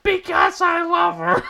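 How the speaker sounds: very high, loud shrieked confession; over-the-top falsetto shriek. A narrator's reading. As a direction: scream it out at the teachers. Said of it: "And then Draco shrieked".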